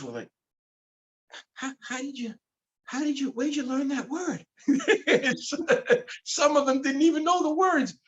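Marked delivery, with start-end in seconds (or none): 1.5-4.4 high, astonished teacher voice; mock the stunned teachers, pitched way up; amazed teachers. Teachers amazed at kid's vocabulary. 4.7-8.0 laughing. Real, not acted. Laughing while retelling.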